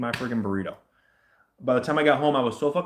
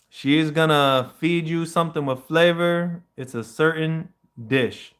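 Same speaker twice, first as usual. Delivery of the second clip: louder oracle voice, stretched; reading a prophecy; 5 semitones higher, 5 dB louder than usual. Retold or imitated. Reading what the spirit foretold, in a loud oracle voice. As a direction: pronounce it like an oracle.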